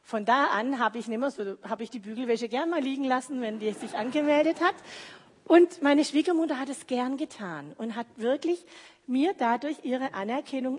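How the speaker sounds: ordinary delivery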